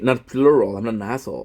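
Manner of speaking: ordinary talk, nothing marked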